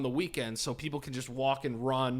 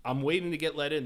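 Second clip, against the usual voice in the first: ordinary delivery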